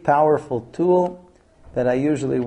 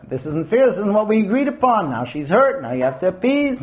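mildly heated complaint, rising in pitch; mock accusation; 7 semitones higher than usual. Shown as a demonstration. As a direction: slip into blaming your wife. Demo of sliding into blame.

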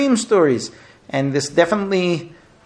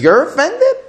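incredulous wife; 13 semitones higher, 6 dB louder than usual. Retold or imitated. Wife scoffs at offended husband.

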